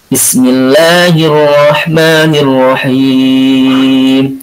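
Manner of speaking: slow, melodic chanted recitation; measured, devotional tajwid recitation; Quran recitation. Acted. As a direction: chant the verse slowly with long held vowels. Recites the verse.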